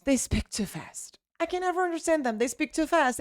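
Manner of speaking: high, soft mock complaint; complaining learner. Teacher quotes what people usually say, mock high-pitched complaint. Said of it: "people usually say that".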